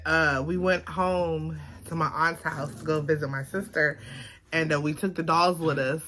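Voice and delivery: ordinary talk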